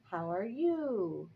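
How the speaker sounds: "you" drawn out, rising at the end; slow "How are you", lifting "you"; rising question. Shown as a demonstration. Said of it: "we go up at the end".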